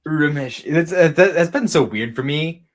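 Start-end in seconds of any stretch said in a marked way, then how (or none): 0.1-0.7 "Urameshi" said a little higher, falling. Shown as a demonstration. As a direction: repeat "Urameshi" the original way. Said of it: "You have to say it".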